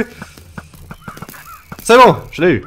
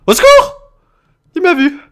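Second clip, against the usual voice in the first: much higher, louder shrieks; hammed-up terror; mock screams for help; 9 dB louder than usual. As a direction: shriek for help as you flee. Fleeing Godzilla.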